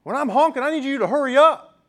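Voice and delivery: high-pitched mock scold; mimicking the honking lady scolding dad; impatient lady. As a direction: imitate a pushy driver demanding he hurry. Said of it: "she's like".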